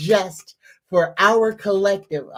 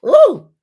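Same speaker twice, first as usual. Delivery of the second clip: high, loud sudden "ooh"; a startled squeal of delight; surprised ooh; 11 dB louder than usual. Real, not acted. Reacts mid-affirmation.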